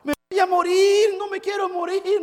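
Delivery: put-on high terrified child's scream; girl screaming. Girl screams to her father in the car. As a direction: scream it like a panicked little girl. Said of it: "la niña comenzó a gritar".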